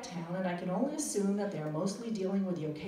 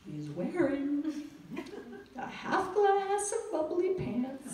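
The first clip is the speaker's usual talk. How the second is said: mock tourist Italian, higher pitched and earnest; halting phrasebook tourist; 10 semitones higher, 6 dB louder than usual. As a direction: describe the lost son in mangled textbook words. Phrasebook Italian describing clothes.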